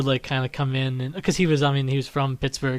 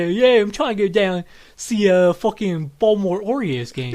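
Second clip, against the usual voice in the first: a lightly done Baltimore accent; easy, light Baltimore accent; 4 semitones higher than usual. Shown as a demonstration. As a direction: slip into Baltimore like it's nothing. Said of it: "better than my regular accent just to go".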